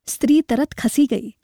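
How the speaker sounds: ordinary delivery, nothing marked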